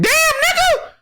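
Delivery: high, slow squealed disbelief; outraged squeak; shocked outcry. Real, not acted. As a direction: react like you can't believe it. Outraged reaction.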